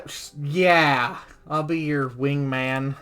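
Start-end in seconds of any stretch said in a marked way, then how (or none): none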